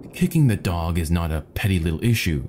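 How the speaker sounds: plain reading voice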